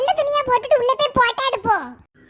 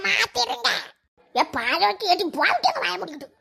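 ordinary talk, nothing marked

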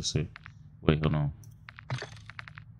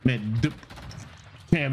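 ordinary talk, nothing marked

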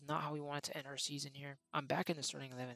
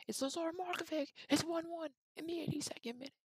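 goal call; 11 semitones higher than usual. Acted. Gamer calling the late equaliser, excited goal shout. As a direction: erupt as the ball hits the net.

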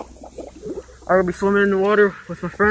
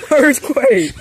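laughing shout; 4 semitones higher, 9 dB louder, much slower than usual. Real, not acted. Joking after the big splash.

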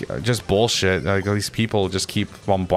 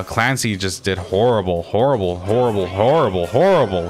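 each "horrible" punched on its first syllable, building; hammered repetition. Real, not acted. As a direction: hit every "horrible" harder, scolding. Berating teammate with repeated word.

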